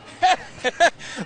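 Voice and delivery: high, loud delighted laugh; burst of laughter. Real, not acted. Reacts to Texan saying.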